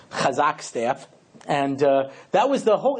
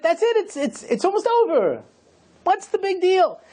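mock questioner; 13 semitones higher than usual. Mocking the questioner again.

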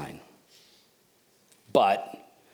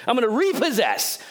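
voicing Jesus; 10 semitones higher than usual. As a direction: proclaim it like a confident champion who just showed up. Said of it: "Jesus is saying".

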